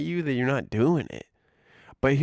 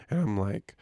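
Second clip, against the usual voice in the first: normal talking voice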